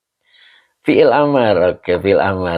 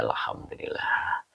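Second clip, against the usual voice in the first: whispered aside; 11 dB quieter than usual. Real, not acted.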